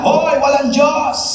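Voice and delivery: angry neighbour screaming there is no God; yelling atheist. Acted. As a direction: yell it over the fence at the praying neighbour. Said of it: "sinisigawan niya".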